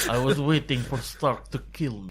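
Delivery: laughing fake Russian accent. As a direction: joke through a mock Russian accent. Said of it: "It's still Russian".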